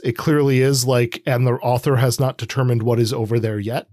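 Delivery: normal talking voice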